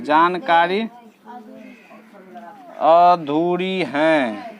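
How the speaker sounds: very slow, each word drawn out; teacher dictating the answer very slowly; slow dictation. Shown as a demonstration. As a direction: dictate it slowly so they can write. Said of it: "तो इसको लिखेंगे".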